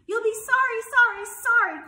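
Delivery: very high, loud goose warning; shrieky taunting call of warning. A narrator's reading. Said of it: "called the goose".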